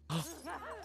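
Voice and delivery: high quick intake of breath; sharp startled gasp. Real, not acted. Player spotting a zombie, sharp startled gasp. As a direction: gasp as something appears.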